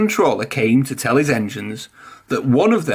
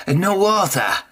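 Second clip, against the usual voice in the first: a short, higher snap; snapping; 6 semitones higher than usual. A narrator's reading. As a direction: bite the words off, a touch annoyed. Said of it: "snapped Spencer".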